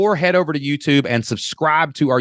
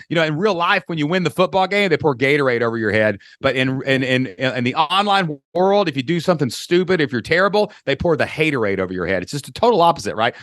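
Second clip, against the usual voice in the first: ordinary delivery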